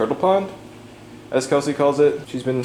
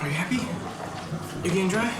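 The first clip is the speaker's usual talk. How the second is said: put-on soft baby talk; 6 semitones higher, 9 dB quieter, much faster than usual.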